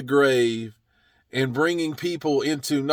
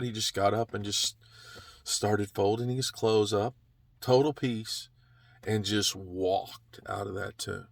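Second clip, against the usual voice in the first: much softer, hushed telling of total peace; hushed calm; 7 semitones lower, 8 dB quieter than usual. A narrator's reading. Preacher picturing Jesus rising in peace, softly hushed. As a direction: drop to a hush as if in the empty tomb.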